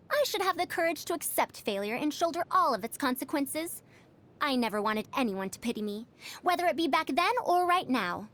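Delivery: normal talking voice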